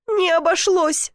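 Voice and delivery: high, slow tearful protest; tearful sob. A narrator's reading. Woman rejecting comfort after fire, sobbing. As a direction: wail it back through tears. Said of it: "всхлипнула".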